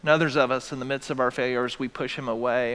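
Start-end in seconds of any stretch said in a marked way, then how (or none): none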